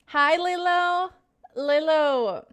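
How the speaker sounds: loud, high, drawn-out greeting; calling out hello. Real, not acted. Streamer spots a regular viewer in chat, calling out hello. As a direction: sing out the name, stretched and loud.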